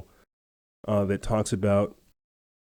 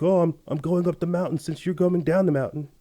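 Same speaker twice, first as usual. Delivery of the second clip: mocking the hiker; 7 semitones higher than usual. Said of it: "He's like".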